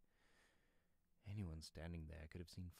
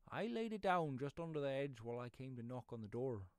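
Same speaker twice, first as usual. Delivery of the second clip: higher, louder rustic reply; eager pedlar; 4 semitones higher, 8 dB louder than usual. Acted. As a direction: answer readily as the pedlar, pitched up.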